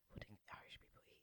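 whispered quick aside. Real, not acted. Podcaster whispering a question to her co-host.

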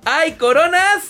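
high slow hawker's cry; vendedor ambulante. Pretend. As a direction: cry out like a street seller. Market vendor hawking wares. (pregón de vendedor)

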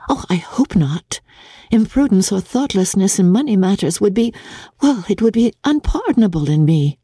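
put-on a young woman bursting with news; Jane's eager voice. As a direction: rush to tell her mother. Jane answers her father.

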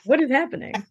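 lightly voiced puzzled bystander; imitated puzzled onlookers. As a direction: play the confused onlooker. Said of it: "People would be like".

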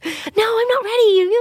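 put-on high whining little girl; a six-year-old refusing bed, whiny; whiny child voice. Child's reply in retold scene.

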